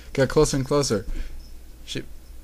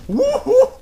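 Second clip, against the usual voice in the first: high, loud whoop; 7 dB louder than usual. Real, not acted. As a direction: let out a shrieking cheer. Reader breaks off to whoop.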